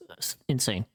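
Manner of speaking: usual speaking voice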